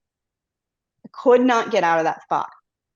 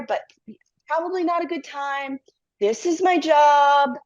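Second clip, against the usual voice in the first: higher, insistent, dragging out "my job"; cling to the job, convincing yourself; stubborn self-persuasion; 6 semitones higher than usual. Retold or imitated. Worker arguing against quitting, higher insistent repetition.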